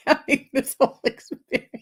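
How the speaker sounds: high, fast giggly talk; laughing through the words; giggling at her own reading. Real, not acted. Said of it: "I'm giggling and laughing".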